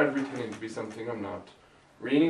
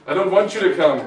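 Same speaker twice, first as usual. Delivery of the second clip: louder, cold refusal; harsh refusal; 6 semitones higher, 8 dB louder than usual. Acted. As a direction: shut her down hard. Edward rejects Bella.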